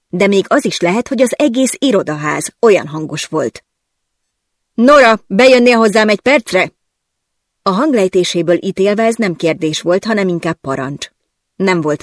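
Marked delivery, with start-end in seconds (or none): 4.7-6.7 loud, higher bellowed summons; boss bellowing. Acted. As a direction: call her in from across the office. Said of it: "a főnököm kisüvöltött az irodájából". (hangosan kiáltva, főnöki hangon)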